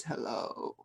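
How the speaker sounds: soft, low, groggy phone answer; sleepy voice. Retold or imitated. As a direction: answer as if just woken, low and quiet. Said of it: "the sleepy voice says".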